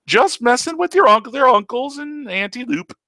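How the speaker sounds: put-on old uncle teasing his niece in a silly voice; teasing old Taco. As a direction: tease her like a cheeky ancient uncle.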